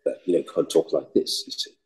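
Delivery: refined, low-pitched upper-class British delivery; Roger Moore. Shown as a demonstration. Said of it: "Roger Moore's a more sophisticated".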